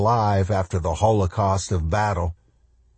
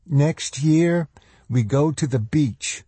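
higher-pitched mother's dialogue; lightly voiced mother; 7 semitones higher, slower than usual. A narrator's reading. Mother to son after the disaster, lightly voiced higher.

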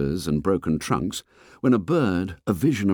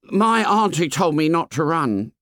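put-on fat boy voice; 8 semitones higher than usual. A narrator's reading. Fat boy complains to the fair boy, high and whiny. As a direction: complain like a chubby schoolboy. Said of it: "he explained".

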